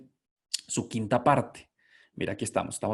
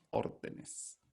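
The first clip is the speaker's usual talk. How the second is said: whispered; 13 dB quieter than usual. Shown as a demonstration. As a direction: whisper órdenes softly, no voice. (susurrando órdenes)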